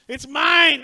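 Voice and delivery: preacher mimicking a toddler shrieking "mine"; screaming child. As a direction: yell it like a greedy toddler. Child in daycare grabbing toy.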